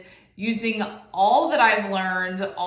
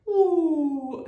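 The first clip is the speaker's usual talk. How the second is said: funny ooh; 7 semitones higher than usual. Retold or imitated. Husband's funny reaction.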